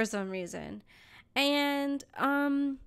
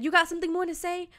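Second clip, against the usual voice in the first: higher, goading question; mock confrontational challenge; retold intercom challenge; 7 semitones higher, 3 dB louder, faster than usual. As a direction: imitate a driver daring someone to answer. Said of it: "over the intercom".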